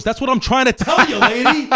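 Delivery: high, loud, fed-up store clerk; comic outburst on a punchline; exasperated shout. Acted. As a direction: burst out at a stubborn customer, laughter creeping in.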